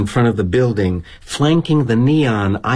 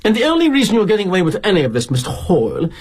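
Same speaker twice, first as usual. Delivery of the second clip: put-on indignant Brit kid; 6 semitones higher than usual. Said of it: "This Brit kid who works for us".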